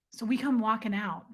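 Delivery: ordinary talk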